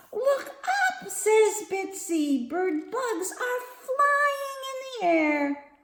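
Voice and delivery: lively, sing-song picture book reading; reading to children. A narrator's reading. Teacher reading Bugs at the Beach to kids, sing-song. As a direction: read it like story time.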